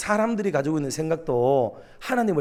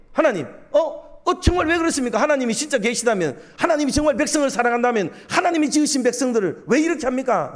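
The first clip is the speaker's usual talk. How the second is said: much higher, agitated challenge to God; huffing, heated protest; 7 semitones higher than usual. Acted. Prophet confronting God for the people, huffing angrily. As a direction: protest indignantly, pitch pushed right up. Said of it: "막 씩씩거리면서 얘기하는 거예요".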